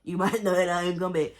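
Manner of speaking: imitating the father, breaking into laughter; laughing mock threat. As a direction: ham up the cold father's line with a laugh. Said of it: "Whenever he was just like".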